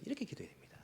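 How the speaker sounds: breathy whispered aside; a whispered aside to the congregation. Real, not acted. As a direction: lean in and whisper it. Aside on how to pray.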